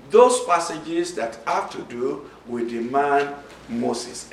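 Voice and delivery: ordinary talk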